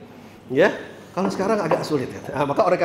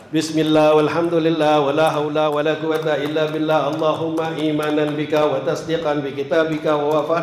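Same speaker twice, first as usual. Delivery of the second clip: even, unbroken monotone recitation; prayer recitation; 4 semitones lower than usual. Shown as a demonstration. Guide showing pilgrims the prayer at Hajar Aswad, even recitation. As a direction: recite the prayer straight through on one note.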